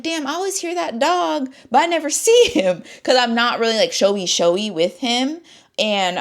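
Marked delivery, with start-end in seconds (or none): 0.0-2.8 high-pitched mock complaint; imitating viewers. Imitating viewers' comments about dog.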